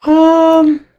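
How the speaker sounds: high, small frightened voice; scared kid voice. Retold or imitated. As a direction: whimper like a scared child. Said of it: "I was like".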